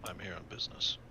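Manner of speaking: low, quiet business voice; a flat, low-pitched man on business; gruff stranger. Acted. Hanzo answering the waitress, low and curt.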